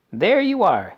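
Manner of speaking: high, loud and triumphant; triumphant cry. A narrator's reading. Mister Wonka shows off his square candies, triumphant. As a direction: crow it out in triumph. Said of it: "he cried triumphantly".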